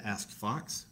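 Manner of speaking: usual speaking voice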